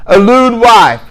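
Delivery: ordinary talk, nothing marked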